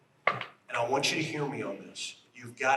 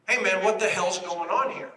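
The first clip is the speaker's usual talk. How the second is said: mock yell; 5 semitones higher, 5 dB louder than usual. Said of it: "He yells at me".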